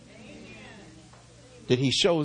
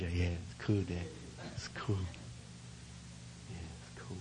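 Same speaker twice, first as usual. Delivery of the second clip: put-on bored teen; 6 semitones lower, 13 dB quieter than usual. Son answering unimpressed.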